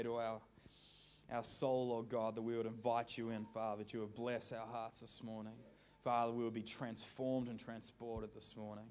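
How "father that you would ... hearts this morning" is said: ordinary talk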